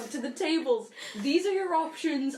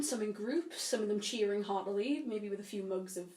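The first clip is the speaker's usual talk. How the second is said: story narration; 5 semitones lower, 6 dB quieter than usual. Acted. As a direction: set the tavern scene calmly. GM scene narration.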